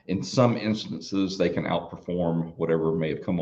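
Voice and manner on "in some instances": ordinary delivery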